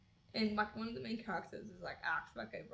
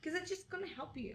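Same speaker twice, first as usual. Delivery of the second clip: nagging mom; 7 semitones higher than usual. Retold or imitated. Acting mom's answer.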